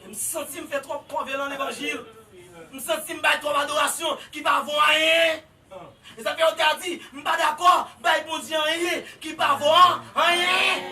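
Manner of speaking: loud, rising sermon climax; full-force preaching. Acted. Preacher shouting to the congregation about worship, full force.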